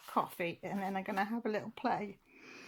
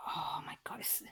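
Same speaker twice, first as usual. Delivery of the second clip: awed whisper; 6 semitones lower, 11 dB quieter than usual. Real, not acted. Overwhelmed by the gift.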